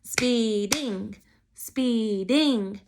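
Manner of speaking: "Speeding" drawn out as two beats, spee-ding; slow two-beat "speeding" for counting syllables; broken into syllables. Shown as a demonstration. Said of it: "Break apart your sound, or syllables".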